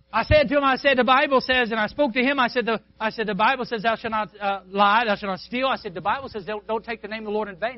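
loud, high preaching of the commandments; full-force preaching. Acted. Preacher to congregation, retelling his witness, loud preaching.

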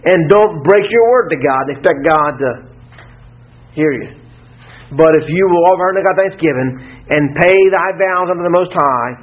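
usual speaking voice

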